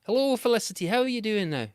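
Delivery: high, loud, badly faked Scottish accent; terrible Scottish accent. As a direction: call out in a hammy Scottish lilt. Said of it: "That was a terrible Scottish accent".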